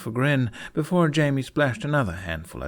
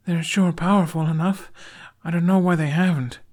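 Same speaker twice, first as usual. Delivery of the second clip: put-on young boy voice; 9 semitones higher, 5 dB louder than usual. Jamie voiced.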